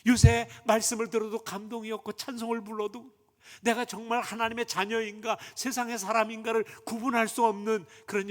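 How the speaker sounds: loud, driving sermon climax; fervent preaching. Acted. Pastor pressing the congregation to self-examine, fervent preaching. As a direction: hammer each 내 인생이 home.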